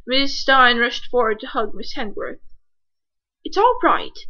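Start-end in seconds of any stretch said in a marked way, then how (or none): none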